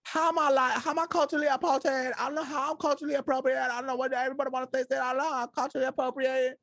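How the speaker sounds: high-pitched, hurried mock complaint; high, rapid mimicry of an aggrieved influencer; imitating young woman online. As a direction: whine defensively in a high put-on voice. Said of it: "her whole I G was like".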